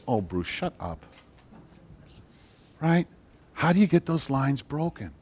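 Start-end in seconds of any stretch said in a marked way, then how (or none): 0.1-1.0 dismissive aside. Retold or imitated. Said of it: "I go".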